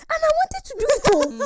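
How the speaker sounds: very high, loud mock protest; protesting excuse. Imitating someone insisting they meant to act, loud protest. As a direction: wail the excuse at full volume.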